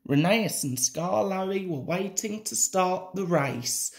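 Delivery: ordinary delivery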